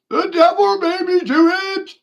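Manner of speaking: very high, loud squeal, punching devil; mock sinner's wail. Mocking excuse repeated.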